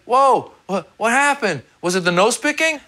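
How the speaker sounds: high, bewildered whoa; mock confusion at her walking away; baffled. Rejected at the club, high and bewildered. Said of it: "I was like".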